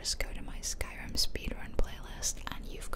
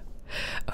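brief hushed giggle; short whispered laugh. Real, not acted. Gamer confessing an in-game murder, short hushed laugh. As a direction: let out a small voiceless laugh.

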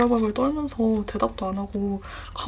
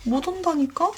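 much higher, frantic insisting; panicked protest; 8 semitones higher than usual. Retold or imitated. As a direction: plead with mom in a fright. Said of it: "완전 기겁을 해가지고".

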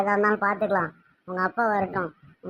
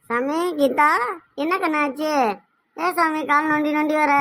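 higher, anxious call to her limping daughter; alarmed mother calling out; 6 semitones higher than usual. Acted. Mother sees daughter limping in, calls out alarmed. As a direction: call out in sudden worry.

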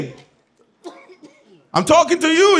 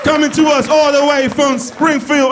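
announcer intro; 7 semitones higher than usual. Real, not acted. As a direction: boom the contestant's hometown. Introducing contestant.

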